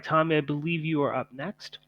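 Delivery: ordinary talk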